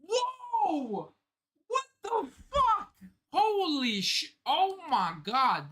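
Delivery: high, drawn-out astonished exclamations; stunned shock. Real, not acted. Manga reader sees the reveal, stunned and high-pitched. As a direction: react in real shock, voice shooting up.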